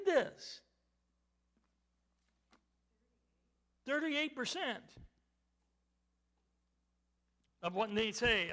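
high, loud preaching in short bursts; forceful preaching. Real, not acted. Preacher dismayed at what church people believe, forceful preaching. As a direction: ring each phrase out, with long pauses between.

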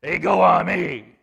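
loud forceful proclamation; Jesus answering Caiaphas, very forceful; very forcefully. Acted. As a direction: say it with full force. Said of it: "saying that very forcefully".

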